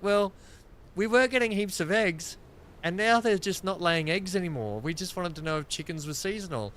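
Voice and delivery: a mock innocent neighbour asking; imitating the neighbours.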